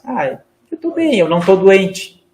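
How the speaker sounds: high-pitched, small, frail reply; feeble, self-pitying "I'm fine"; fragile, weakened tone. Shown as a demonstration. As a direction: say it like someone already feeling sick. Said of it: "se fragilizando".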